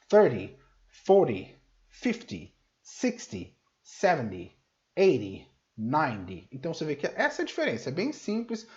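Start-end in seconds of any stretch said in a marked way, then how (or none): 0.1-6.5 Thirty, forty punched loud at the start; teacher drilling first-syllable stress on the tens. Shown as a demonstration. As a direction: hit the first syllable of each tens word. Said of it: "o foco tá no começo".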